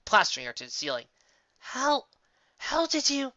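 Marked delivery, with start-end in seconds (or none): 1.6-3.3 high, gasping disbelief; stunned. Acted. Friend gapes at pony stuck to ceiling, stunned. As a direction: blurt it out in shock, much higher. Lyra shocked at Bon Bon stuck.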